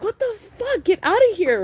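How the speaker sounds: high, indignant mock yell; high-pitched outraged mock yell; upset outburst. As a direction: shoo the intruder out, half laughing. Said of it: "you get all upset".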